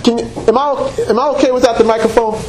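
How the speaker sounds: normal talking voice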